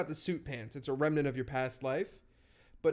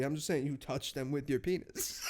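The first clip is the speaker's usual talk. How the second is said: ordinary delivery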